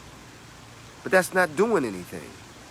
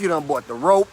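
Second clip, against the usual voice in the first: ordinary talk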